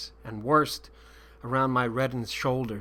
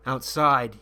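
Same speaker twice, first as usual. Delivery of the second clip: ordinary delivery, nothing marked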